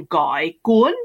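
GUY dipping into a question, GUN rising sharply; Vietnamese tones. Shown as a demonstration. Guest naming the salad roll for hosts, Vietnamese tones. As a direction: lift GUN at the end, after a questioning GUY. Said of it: "little kind of question mark".

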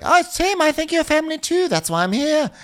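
high, sugary mock sweetness; mock sweet Dorit. Dorit reassuring Tim, high and sugary. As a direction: coo it like a syrupy friend. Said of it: "Dorit's like".

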